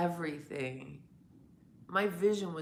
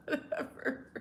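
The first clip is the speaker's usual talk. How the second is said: laughing, higher; 10 semitones higher, 5 dB louder than usual. Real, not acted. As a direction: dismiss it through a laugh. Laughing dismissively.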